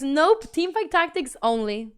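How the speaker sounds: ordinary talk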